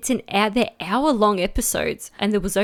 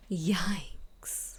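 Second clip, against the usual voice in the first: whispered yikes; 3 semitones lower, 15 dB quieter than usual. Shown as a demonstration. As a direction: whisper yikes, soft and airless. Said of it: "It's not like".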